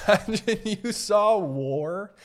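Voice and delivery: laughing through the words, slowed. Real, not acted. Amused by old veterans getting casino emails, laughing. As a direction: say it while cracking up.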